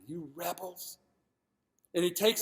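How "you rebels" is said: mad. Acted. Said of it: "but he's mad".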